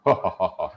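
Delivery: low, mocking lion laugh; lion's laugh. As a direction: laugh down deep at the tiny mouse. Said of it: "The lion said".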